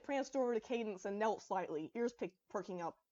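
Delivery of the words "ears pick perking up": ordinary delivery, nothing marked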